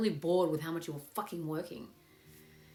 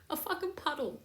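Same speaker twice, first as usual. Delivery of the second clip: usual speaking voice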